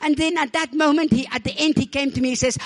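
ordinary delivery

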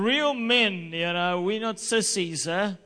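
mock macho taunt. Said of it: "he was basically saying".

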